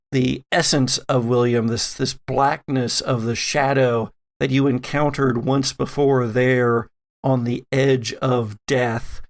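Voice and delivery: ordinary delivery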